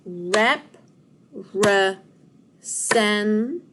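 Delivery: "Rep", "re", "sen" as loud, separate beats; syllable by syllable. Shown as a demonstration. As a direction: punch out "Rep", "re", "sen" with gaps between. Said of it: "One more time".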